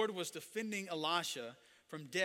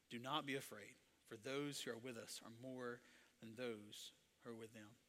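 low, soft, unbothered delivery; cool, collected prophet, quietly sure; easy calm voice; 4 semitones lower, 9 dB quieter than usual. Acted. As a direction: say it as if nothing bothers you. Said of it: "And it's easy, calm voice".

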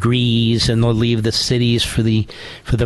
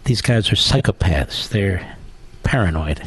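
ordinary delivery

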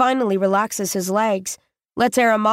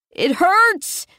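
high, strained cry of pain; a wounded boy crying out in pain; 8 semitones higher than usual. Acted. Stabbed boy crying out to the girls in pain.

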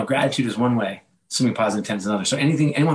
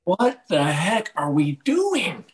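slow, higher-pitched exasperation; mock dismay; 6 semitones higher, much slower than usual. Husband faulting his own double standard, mock dismay. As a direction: draw it out in playful disbelief.

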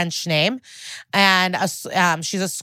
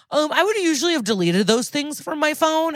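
high-pitched put-on voice of a clueless culprit; mocking imitation; 8 semitones higher than usual. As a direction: mimic him missing the point. Mocking what he is implying.

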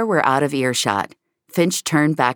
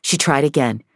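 ordinary delivery, nothing marked

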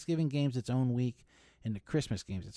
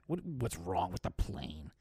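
mocking repeat; 6 semitones lower, 7 dB quieter, slower than usual. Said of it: "What do you care, [name]?".

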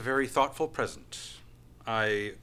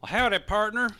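put-on old-timey Western accent; 7 semitones higher, 9 dB louder, faster than usual. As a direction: greet like a Western sheriff. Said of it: "speak in old-timey Western accents".

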